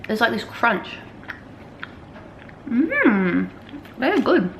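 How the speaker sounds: talking through a mouthful of chocolate; words round a bite of food; mouth full. Real, not acted. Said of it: "spoken on camera with my mouth full".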